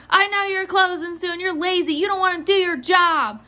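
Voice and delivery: high-pitched accusing rant; imitated mad customer. Clerk mimics customer calling her lazy, mad. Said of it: "She was so mad".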